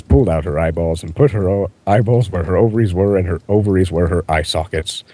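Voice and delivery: ordinary delivery, nothing marked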